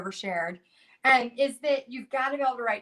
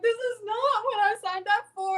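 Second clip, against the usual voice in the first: panicked; 11 semitones higher than usual. Retold or imitated. Panicked quote while killing snake.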